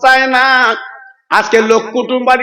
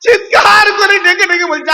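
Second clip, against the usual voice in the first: high-pitched preacher's cry; shouted, high chant; 8 semitones higher than usual. Acted. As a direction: cry it out high, as if calling in desperation. Narrator acts out Fatema's screaming call.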